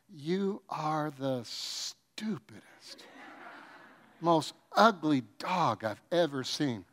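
soft, slow sugary voice over cruel words; sweet talk to a dog. Pretend. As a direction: coo it like baby talk. Sweet-talking insults to the dog.

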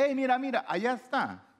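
playful hammed-up excitement, pitched high; mock excited pointing. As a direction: point and call out like a kid spotting something.

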